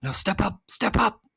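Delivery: very high little guide voice; recommending voice. A narrator's reading. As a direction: coax them on in a high-pitched voice. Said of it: "it recommended".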